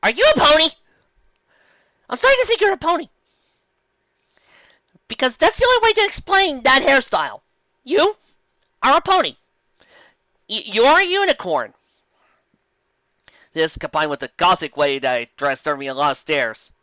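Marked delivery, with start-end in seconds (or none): 0.0-11.7 much higher, loud incredulous accusing; shrill-high mock accusation of being a pony. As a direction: squeal it like you caught them out.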